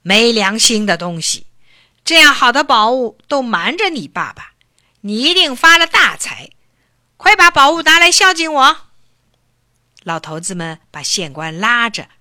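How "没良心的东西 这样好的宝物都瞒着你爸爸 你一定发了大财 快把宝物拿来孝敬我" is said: loud, building furious scolding; angry scolding. A narrator's reading. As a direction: fathers berating the son together. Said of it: "他们都用一样的声音骂道". (众爸爸越骂越凶)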